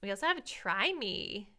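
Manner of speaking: ordinary talk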